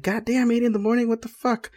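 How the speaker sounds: high, hushed incredulous protest; retold disbelief. Grumbling about an eight a.m. team meeting, incredulous. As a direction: balk at it in a high, softened voice. Retells reaction to early meeting.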